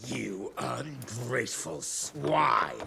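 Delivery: low slow indignant insult; indignant retort. Acted. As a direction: spit it out slowly, outraged. Secretary insulted in return.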